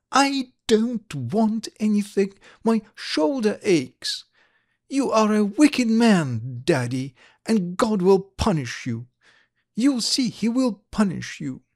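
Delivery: high-voiced cross child; angry little girl. A narrator's reading. Little girl with aching shoulder blaming her daddy, angry. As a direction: scold your father in a high, petulant child's voice.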